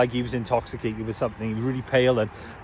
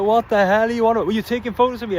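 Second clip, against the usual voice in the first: higher, louder exasperated challenge; retold indignation; 8 semitones higher, 4 dB louder than usual. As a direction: act out fed-up disbelief, pitched up. Retold quarrel with the accuser.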